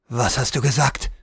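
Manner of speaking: low, sharp challenge; scharfe Frage. A narrator's reading. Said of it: "fragte Ahmed scharf". (scharf und tief nachfragen)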